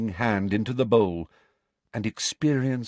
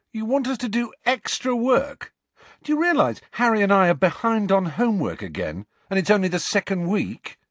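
Ron protesting; 12 semitones higher, 5 dB louder than usual. Acted.

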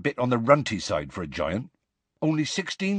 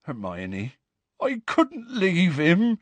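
tearful; 8 semitones higher, slower than usual. A narrator's reading. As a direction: plead through tears. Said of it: "tears now trickling down his bruised face".

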